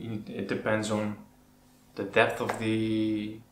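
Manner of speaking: ordinary talk, nothing marked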